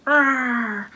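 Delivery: short playful growl like a charging dog; mock dog growl. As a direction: growl like the dog lunging for the bone.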